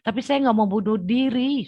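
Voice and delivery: high, loud tearful cry; crying plea. Retold or imitated. Begging God in tears not to give in, high tearful wail. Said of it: "Saya udah nangis-nangis itu".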